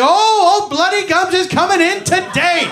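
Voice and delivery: loud, high and thrilled, greeting a rich patient; excited dentist voice. Retold or imitated. Dentist thrilled to see a patient with bad gums, loud and gleeful. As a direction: cheer like he just hit the jackpot.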